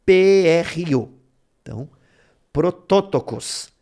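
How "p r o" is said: P, R, O said slowly and louder, each letter apart; spelled out letter by letter. Shown as a demonstration. Teacher spells the transliterated root for students, slow and loud. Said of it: "você vê no transliterado".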